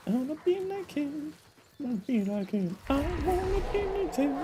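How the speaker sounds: high, soft squeaky voice; squeaky mock voice. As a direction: say it like a tiny fan. Repeats line in squeaky voice.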